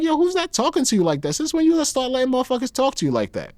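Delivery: high, mocking girl's voice, pushed up all the way; ex-girlfriend's voice. Ex-girlfriend asks who talks to him like that, in a high girl's voice. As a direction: voice his ex like a girl ready to start a fight.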